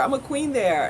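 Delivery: ordinary delivery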